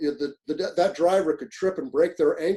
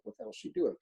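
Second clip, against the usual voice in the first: inner thought; 8 semitones higher, 8 dB quieter, faster than usual. Retold or imitated. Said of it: "I'm thinking to myself".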